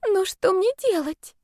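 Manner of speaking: put-on high, slow plaintive girl; tearful helplessness. A narrator's reading. Lovesick girl asks roommate for help, high and plaintive. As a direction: ask helplessly, pitched high and drawn out.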